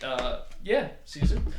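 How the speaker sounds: ordinary talk, nothing marked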